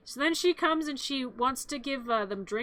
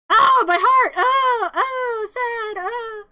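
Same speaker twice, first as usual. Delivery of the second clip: high, loud crying wail of heart trouble; high melodramatic sobbing cries that fade; hammed-up wailing; 9 semitones higher, 7 dB louder, much slower than usual. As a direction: ham up a fake heart attack, wailing. [name] fakes heart trouble.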